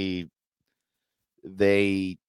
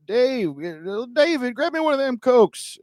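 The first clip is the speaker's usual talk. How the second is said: put-on Southern accent; 13 semitones higher than usual. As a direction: call to a kid for a soda. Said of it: "someone being like".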